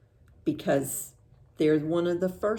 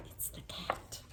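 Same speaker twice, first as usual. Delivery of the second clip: whisper; 15 dB quieter than usual. Acted. Birds warn of the cat.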